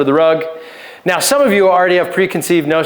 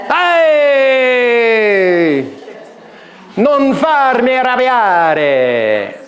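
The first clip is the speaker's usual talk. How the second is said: hammed-up angry Italian; 5 semitones higher, much slower than usual. Illustrating volatile couples, hammed-up Italian warning. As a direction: bark it like a fiery Italian, stretching the words. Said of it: "typical characteristic Italian".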